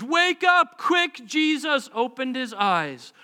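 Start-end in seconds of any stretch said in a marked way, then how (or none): 0.0-1.9 loud, high, urgent cry; screamed plea. A narrator's reading. Disciples in the storm wake Jesus, screaming urgently. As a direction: scream to rouse a sleeper, dragging it out. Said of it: "they screamed".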